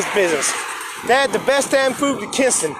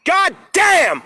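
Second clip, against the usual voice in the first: angry shouted curse; 4 semitones higher, much slower than usual. Real, not acted. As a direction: bark it at the broken tablet. Struggling with the tablet.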